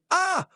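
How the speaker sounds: sudden high yelp of fright; startled scream. Acted. Mock shriek at a ghost popping out of a cookie.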